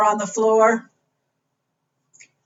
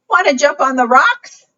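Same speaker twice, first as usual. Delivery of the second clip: high, louder inviting question; storybook goat; 7 semitones higher, 6 dB louder than usual. Acted. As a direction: lightly voice an eager goat. Reader voices the goat's question.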